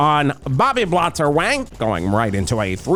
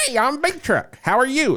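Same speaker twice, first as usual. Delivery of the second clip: much higher twangy country greeting; a folksy country version of the mascot; country Chuck Entertainment; 11 semitones higher than usual. Retold or imitated. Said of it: "sounds like a country, uh, Charles Entertainment".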